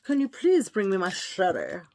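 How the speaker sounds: put-on posh English, unhurried at first. Woman playing aristocrat calls Jeeves for service, mock-posh. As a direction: play a grand aristocrat addressing a servant. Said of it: "Like my posh voice?".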